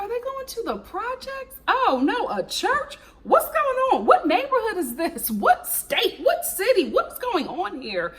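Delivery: high, rapid-fire confused questions; confused alarm. Retold or imitated. Vlogger retelling her shock at the location, high and bewildered. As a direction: pile up the questions in mock alarm. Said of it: "I was like".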